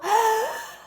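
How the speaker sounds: quick high gasp; sharp shallow intake of breath. Shown as a demonstration. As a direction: show a tense shallow breath. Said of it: "You hear that, it's very different".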